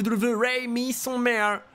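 slow, stretched ring announcer call; big arena introduction, stretched out. Pretend. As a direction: drag the name out like a ring introduction.

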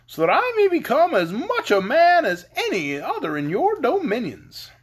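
high-pitched, swooping, eager figure; brave Scarecrow. A narrator's reading. Scarecrow begging the lady for brains, brave. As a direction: pipe up boldly and loudly. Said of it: "answered her bravely".

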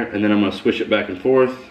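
ordinary delivery

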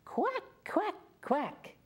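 put-on high, lively duck quack for the children to copy; quacking with kids. Shown as a demonstration. Teacher leads children in quacking, high and lively. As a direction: lead the kids in a big quack. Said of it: "can you say that again with me".

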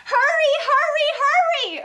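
high, loud, fast urging; urgent. A narrator's reading. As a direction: push them to run, fast and loud. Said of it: "urged Bear".

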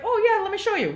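high mock sweet clerk voice; imitating the clerk. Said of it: "She's like".

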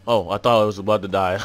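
ordinary delivery

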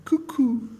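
high cooing "cuckoo" like a pigeon. A narrator's reading. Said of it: "She even went".

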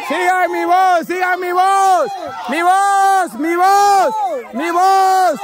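high, drawn-out guiding call; insistent calling across a field. Real, not acted. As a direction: chant it over and over for players to follow. Calling blindfolded players toward his voice.

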